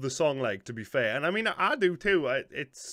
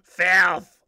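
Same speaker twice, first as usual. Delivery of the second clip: "Filth!" bellowed much louder; mock outraged shout; 3 semitones higher, 12 dB louder than usual. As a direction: belt "Filth!" out in hammed-up outrage. Repeats word, shouted.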